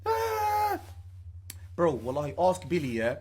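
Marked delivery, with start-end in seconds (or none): 0.1-0.8 panicked yelp. Retold or imitated. Fleeing in panic.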